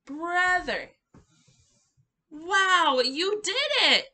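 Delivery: high, loud, thrilled cheer; excited praise. Shown as a demonstration. Teacher cheering toddlers who signed brother, excited and loud. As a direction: celebrate the child's success. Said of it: "Got brother".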